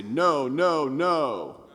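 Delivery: no nasal ring, sound kept in the mouth; a dull, covered no; dull mouth-only sound. Shown as a demonstration. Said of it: "that has kind of a dull quality because I'm isolating in the mouth cavity".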